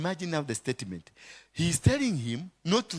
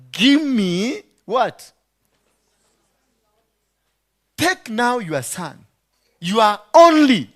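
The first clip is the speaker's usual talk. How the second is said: sarcastic God; 7 semitones higher, 10 dB louder, slower than usual. Acted. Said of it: "he was very sarcastic".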